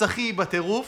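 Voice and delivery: ordinary talk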